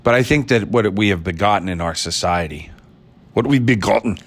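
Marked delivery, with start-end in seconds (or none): 3.3-4.2 put-on brief Scottish accent, pitched higher; Sean Connery. Podcaster repeating his begotten joke, in a Sean Connery accent. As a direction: slip into a mock Scottish brogue. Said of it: "I had to do it again".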